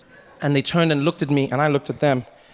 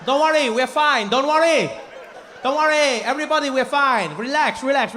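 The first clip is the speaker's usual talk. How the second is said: high, insistent snake charmer voice; frantic reassurance; 7 semitones higher than usual. Acted. Bitten charmer to the crowd, over-eager high reassurance. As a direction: keep insisting all is well, pitched high.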